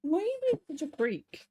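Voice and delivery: high, soft chiding of a pet; pet talk. Real, not acted. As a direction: tease the rabbit in a high little voice. Scolding rabbit in pet voice.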